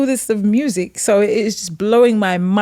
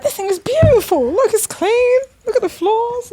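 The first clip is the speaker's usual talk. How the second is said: high, excited gushing; gushing high over the Winnebago; 13 semitones higher than usual. Retold or imitated. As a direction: show off the clean floors with delight. Admiring the Winnebago.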